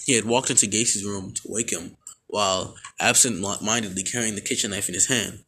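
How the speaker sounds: normal talking voice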